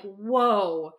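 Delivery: ordinary delivery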